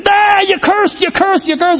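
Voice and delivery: high, gleeful schoolyard taunt; mocking taunt. Coworkers catching him curse, gleeful high taunt. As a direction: sing it out like kids catching someone. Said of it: "And they said".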